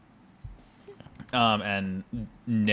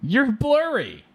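very high-pitched mock outcry, drawn out at the end; mock exclamation; 3 dB louder than usual. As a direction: imitate someone crying out in a squeaky high voice. Acted quote in a retold film anecdote.

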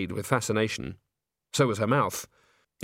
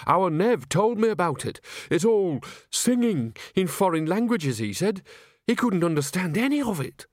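higher, lively country woman; chatty character voice, swooping up; Nanny character; 8 semitones higher than usual. Acted. Nanny passing on what Nev said about opera, chatty higher voice.

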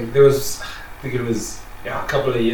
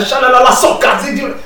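high, loud gibberish chant; mock speaking in tongues; 13 semitones higher, 7 dB louder than usual. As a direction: rattle off fake tongues like a preacher. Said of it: "This guy is speaking in proper tongues".

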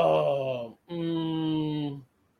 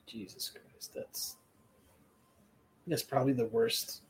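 breathy whispered groan, much softer; 5 semitones lower, 10 dB quieter than usual. Real, not acted. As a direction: groan it under your breath in despair. Groaning at the show.

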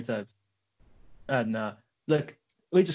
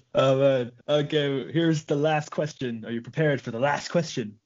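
ordinary talk, nothing marked

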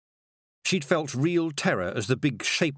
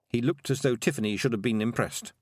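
ordinary delivery, nothing marked

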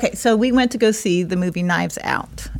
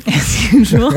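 louder teasing echo of his stumble; mimicked stumble; 3 semitones higher, 6 dB louder, slower than usual. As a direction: playfully mimic his faltering "usual", loud. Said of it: "Why did you stutter over that?".

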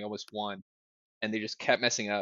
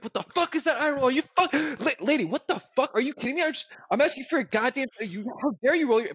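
high-pitched retold tirade, tumbling out; mock gamer rage; 13 semitones higher, 5 dB louder, faster than usual. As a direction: act out the outburst you nearly had at the sandwich lady. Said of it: "like, raging".

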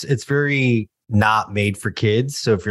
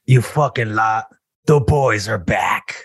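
menacing Butcher. Pretend. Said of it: "tagline in a butcher voice".

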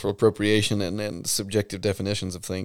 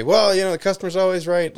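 high, loud sing-song mocking; mocking the customer-is-always-right line; mock cliché; 10 semitones higher, 5 dB louder than usual. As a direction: parrot the platitude mockingly. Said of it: "expect me to just accept it and say".

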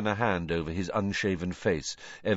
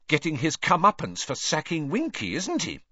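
higher-pitched cold disapproval; 9 semitones higher than usual. A narrator's reading. Hermione judging Crouch for sacking Winky, coldly. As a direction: say it coldly, tag question pitched up. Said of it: "said Hermione coldly".

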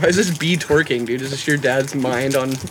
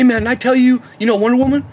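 hustler's pitch; 8 semitones higher, 4 dB louder than usual. Retold or imitated. Retelling stranger's eager pitch.

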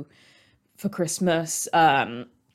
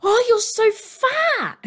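very high, slow, loud mock shout through laughter; hammed-up relative blurting it out; blunt Chinese relative; 5 dB louder, slower than usual. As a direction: squeal it like a tactless aunt. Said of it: "her family will be like".

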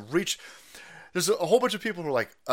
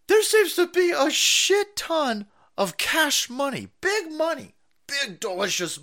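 high, drawn-out greedy voice, slowed and louder; mock greedy gush; 11 semitones higher, 5 dB louder than usual. Gushing over conservative podcast money in a high, hammy voice. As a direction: ham up a money-hungry drool over cash.

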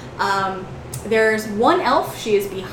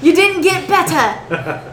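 teasing elf; 6 semitones higher than usual. Acted. Lily teasing the lizard about his warlock mother. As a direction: deliver the newt joke with glee.

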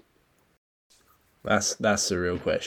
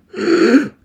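a sudden high sharp gasp for air; mock drowning gasp; 10 dB louder than usual. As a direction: gulp air like a wave just hit you. Retold struggle in rough sea.

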